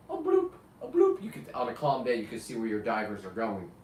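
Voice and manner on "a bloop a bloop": bubble sound. Retold or imitated. Imitating diver bubbles.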